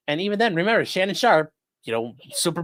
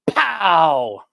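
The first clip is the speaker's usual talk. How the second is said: cartoon punch shout; 3 semitones higher, 7 dB louder than usual. Mario punches Bowser.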